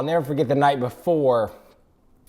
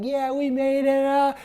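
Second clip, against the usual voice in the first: imitated celebration; 9 semitones higher than usual. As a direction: mimic a friend cheering to his own camera. Imitates friend filming himself celebrating.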